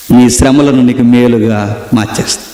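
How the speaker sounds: God's promise. Acted. Preacher voices God's promise.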